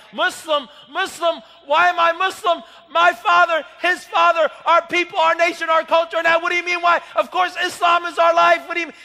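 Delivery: loud, indignant outburst in the father's voice; furious shouting father; angry father shouting. Retold or imitated. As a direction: roar it like an outraged father defending his faith. Father furious at son's question.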